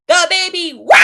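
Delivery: put-on loud wailing baby cry; a loud crying-baby wah. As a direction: wail like a newborn, loud. Storyteller voices baby's wail.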